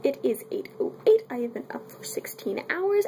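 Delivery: normal talking voice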